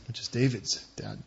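ordinary talk

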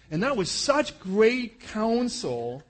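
big ironic thumbs-up to the advice; ironic praise. Real, not acted. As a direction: drag it out, laying the irony on thick.

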